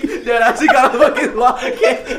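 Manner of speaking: laughing. Real, not acted. Cracking up over the lava cake.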